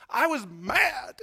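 loud and slow, dragging out mad; mad shout. Acted. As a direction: bellow the anger out slowly. Preacher shouting his anger.

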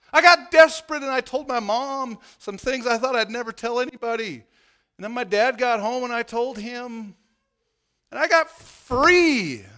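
high, loud fervent preaching; full-force preaching. Acted. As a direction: pour it out on the congregation, high and loud.

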